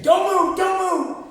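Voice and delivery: high, loud shout of don't move; loud panicked command; shouted warning. Retold or imitated. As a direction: bark it like a startled man with a gun. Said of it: "he kept saying".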